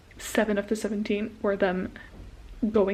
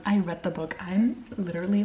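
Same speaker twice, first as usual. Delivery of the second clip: normal talking voice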